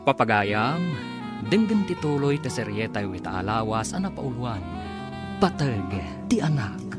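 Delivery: usual speaking voice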